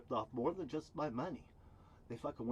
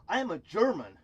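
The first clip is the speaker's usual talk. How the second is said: louder, higher mock of a proud boast; proud declaration; 7 semitones higher, 11 dB louder than usual. Vlogger imitates a man proudly declaring his identity, louder and higher. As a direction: puff up and proclaim it like him. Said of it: "You know, like that".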